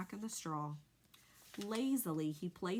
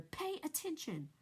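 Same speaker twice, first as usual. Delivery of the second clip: urgent yell; 9 semitones higher than usual. A narrator's reading. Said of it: "screamed Wilbur".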